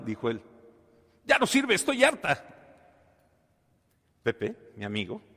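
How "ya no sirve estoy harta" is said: much higher and louder, fed up and whiny; the exasperated wife tells her husband, a high, loud fed-up complaint. Acted. Wife complains to husband the washer broke, high fed-up wail.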